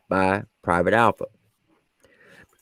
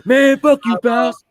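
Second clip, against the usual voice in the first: high squeaky laughing retort; laughing brush-off; 4 dB louder, much slower than usual. Retold or imitated. Drill sergeant brushes off the private, laughing high. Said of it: "I'm like".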